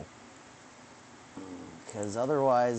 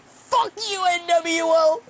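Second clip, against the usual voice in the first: much higher, louder triumphant yelling; triumphant shout; 12 semitones higher, 6 dB louder than usual. Retold or imitated. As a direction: scream it like you caught them red-handed. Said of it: "And I'd be like".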